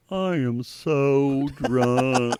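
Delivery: slow, slurring dog voice; slow drunk dog voice. Pretend. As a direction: drag it out like a tipsy dog. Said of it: "looking up at Mark saying".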